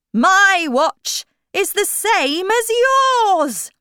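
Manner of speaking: high, loud, slow eccentric questioning; Mad Hatter. A narrator's reading. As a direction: quiz loudly, stretching the words. Hatter voiced in story.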